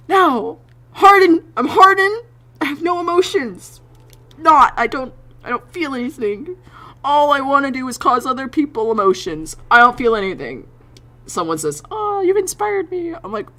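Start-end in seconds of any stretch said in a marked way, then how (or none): 0.1-10.7 loud, high protest of being unfeeling; hammed-up tough act in a high voice; mock denial. Protesting they have no emotions. 11.9-13.1 high, gushing fan voice; mock sweet admirer, pitched way up; imitated fan.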